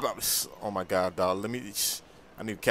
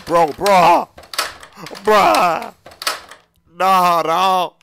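loud, high cries of disbelief; panicked yelling; 7 semitones higher, 9 dB louder, much slower than usual. Acted. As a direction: yell out in alarm. Panicking at the stuck door.

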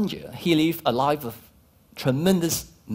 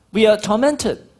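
usual speaking voice

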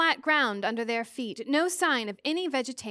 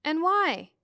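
short, higher-pitched demand; 5 semitones higher, 4 dB louder than usual. A narrator's reading. Charles presses for a reason, demanding. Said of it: "Charles Wallace demanded".

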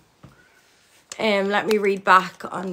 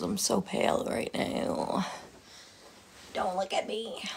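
embarrassed whisper; 7 dB quieter than usual. Real, not acted. Embarrassed about being pale.

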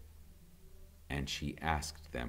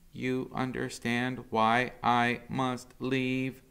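a touch higher, slow robot voice; lightly voiced robot; 8 semitones higher, 4 dB louder, slower than usual. Roz explaining to her son why she must go, lightly voiced. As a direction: speak slowly and gently as the robot. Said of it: "said the robot".

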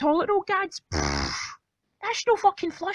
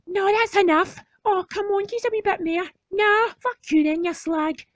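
normal talking voice

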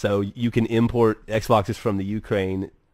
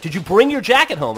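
higher, louder mom demanding an answer; nagging mom; 7 semitones higher, 8 dB louder than usual. Retold or imitated. Mom asking her kid on the last day of school, nagging. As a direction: imitate a mother pressing her kid.

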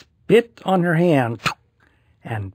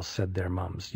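ordinary delivery, nothing marked

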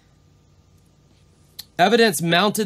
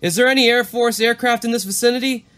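higher, urgent radio call; worried pilot; 8 semitones higher, 3 dB louder, faster than usual. Retold or imitated. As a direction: ask it like a pilot getting nervous. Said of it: "Back to Valentik".

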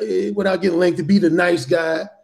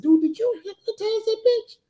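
much higher, incredulous friend voice; amazed friend; 12 semitones higher, 4 dB quieter than usual. Retold or imitated. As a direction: ask in disbelief, pitched up. Friend's amazed question retold.

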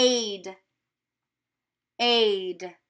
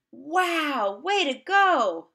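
excited praise; 5 semitones higher, much slower than usual. Real, not acted. Teacher praising the class.

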